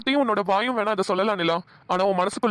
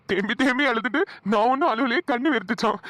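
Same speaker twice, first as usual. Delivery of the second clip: ordinary talk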